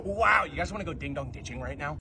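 ordinary delivery

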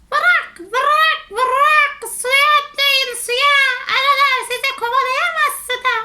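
high, loud frog voice; very high, loud cartoon frog. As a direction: croak the first words, then urge them on. Frog urges animals into water.

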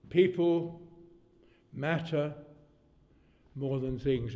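spaced out word by word, long pauses; emphatic saying. Retold or imitated. Preacher passing on a Cockney's wise saying, slow and emphatic. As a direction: weigh each word of the quoted saying.

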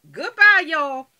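high, loud sung-out farewell; loud goodbye. Real, not acted. Rushing back to her burning pork chops, loud goodbye. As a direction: belt the goodbye as you rush off. Hurrying off to the stove.

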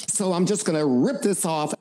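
mock the actor's high, unmacho voice; lightly voiced wimpy actor; weak high voice. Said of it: "the actor, except when he said".

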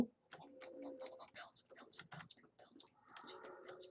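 whispered bounce; whispered chant. Real, not acted. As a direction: hush it while sneaking. Whispering while sneaking.

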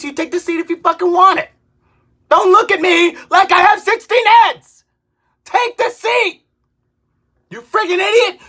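exasperated yelling at the man who won't take the seat; angry outburst. Retold or imitated. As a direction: snap at the staring man.

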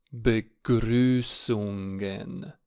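"Begrüßungen" stretched out very slowly for learners; slow, clear pronunciation. Shown as a demonstration. Teacher repeats the lesson's German title to beginners, slow and clear. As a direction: draw out every syllable of "Begrüßungen". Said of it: "încet".

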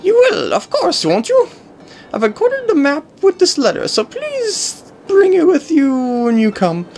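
high, sugary princess letter reading; sweet royal plea, lilting; Princess Peach voice. Acted. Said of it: "Princess Peach's voice".

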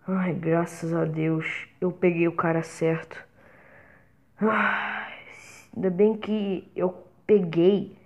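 ordinary talk

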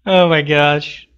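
ordinary delivery

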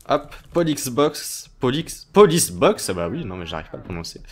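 normal talking voice

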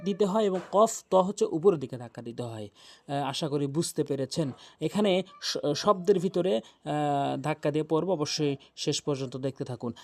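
usual speaking voice

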